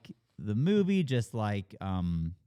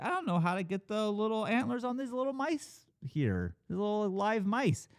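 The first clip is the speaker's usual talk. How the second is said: raised, put-on voice of a worried crew member; flustered crew guy; 8 semitones higher, 4 dB quieter than usual. Crew guy asking boss about mice, lightly voiced. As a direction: play the nervous guy with the mice, pitched up.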